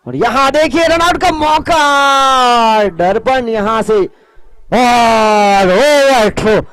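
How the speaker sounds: rising live play-by-play, drawn out; excited cricket commentary. Acted. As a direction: call the run-out chance as it happens. Live play-by-play of run-out chance.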